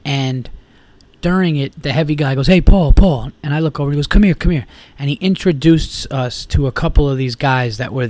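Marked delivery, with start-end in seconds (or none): 2.5-3.3 loud, imitated call across a bar; retold holler to a friend. Calling him across the bar. 4.1-4.6 louder, higher imitated beckoning call; retold urgent call to join; calling over. Beckoning him over at the bar.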